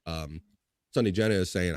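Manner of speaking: ordinary delivery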